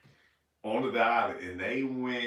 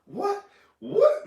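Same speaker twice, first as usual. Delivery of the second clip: surprised what; 7 dB louder than usual. Retold or imitated. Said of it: "and I was going".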